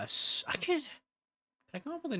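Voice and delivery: lightly put-on demon voice; hint of demon voice. Streamer pondering a demon ASMR in an odd voice.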